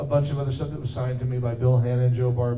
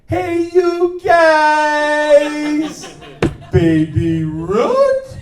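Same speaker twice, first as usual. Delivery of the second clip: much higher, much louder, very slow cartoon shout; secret new character; 14 semitones higher, 11 dB louder, much slower than usual. Shown as a demonstration. Voice actor previews his secret new character, shrieking slowly. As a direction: yell it out in a wild squeaky cartoon voice. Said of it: "you will hear me go".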